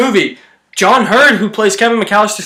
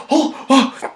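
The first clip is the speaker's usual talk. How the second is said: softer, high little whimper; a touch of mock fright, quiet; mock scared Marv; 5 semitones higher, 4 dB quieter than usual.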